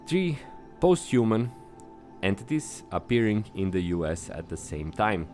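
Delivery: ordinary talk, nothing marked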